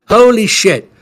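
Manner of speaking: a sudden light-bulb moment, burst out; excited exclamation. Retold or imitated. Recalling the journal entry where it finally clicked, excited.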